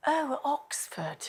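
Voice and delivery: soft, slow, impressed "Oh, oh, Oxford"; awed foreigner. Retold or imitated. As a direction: sigh over Oxford's glamour. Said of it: "they go".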